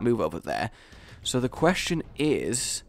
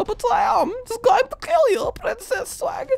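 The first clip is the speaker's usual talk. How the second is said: much higher mock German accent taunt. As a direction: threaten the rival in a hammed-up German accent. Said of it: "I'm getting German here".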